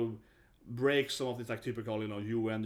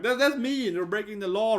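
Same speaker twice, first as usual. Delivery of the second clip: whining Russia; 8 semitones higher, 5 dB louder than usual. Retold or imitated. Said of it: "the Russians were like".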